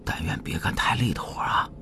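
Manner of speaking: breathy whisper, keeping it secret; whispered wishful grumble to friends; whispered chatter. A narrator's reading. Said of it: "吕川却说起来没完了". (小声念叨，只用气声)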